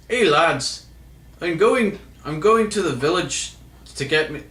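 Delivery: high, lilting attempt at an Irish accent. Shown as a demonstration. Vlogger attempting his best Irish accent on request, high-pitched Irish accent. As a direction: put on a clumsy Irish accent. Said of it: "Do your best Irish accent".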